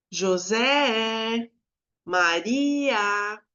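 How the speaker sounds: JOSÉ and MARIA called out, stressed vowel held long and sustained; drawn-out calling of José and Maria; calling a name. Shown as a demonstration. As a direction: call "José, Maria" as if summoning them, stretching ZÉ and RI. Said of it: "chamar a palavra, como se eu falasse".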